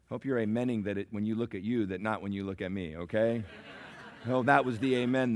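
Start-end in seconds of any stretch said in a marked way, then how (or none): none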